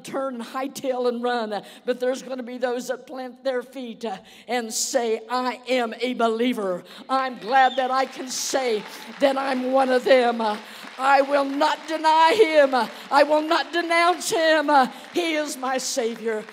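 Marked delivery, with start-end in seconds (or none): 5.3-16.4 defiant declaration. Acted. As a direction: plant your feet and proclaim it. Believer declares faith under questioning.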